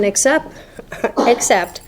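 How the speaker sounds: a rueful laugh, rising then falling. Real, not acted. Speaker laughing at her own past to the crowd. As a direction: break into a laugh on except.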